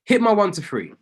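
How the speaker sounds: excited cheer over a winning trade; getting excited. Real, not acted. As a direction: burst out as the trade hits. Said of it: "getting excited".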